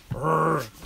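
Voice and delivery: drawn-out throaty "urgh"; strained grunt. Real, not acted. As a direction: grunt while wrestling an excited dog. Struggling with the excited dog.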